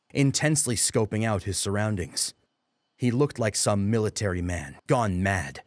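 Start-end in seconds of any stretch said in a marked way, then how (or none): none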